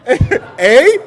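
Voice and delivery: high loud laughing Canadian eh; a jokey Canadian eh; a mock Canadian eh, laughing. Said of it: "quoting himself in Canadian".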